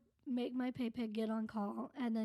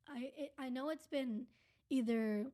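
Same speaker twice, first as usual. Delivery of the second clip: usual speaking voice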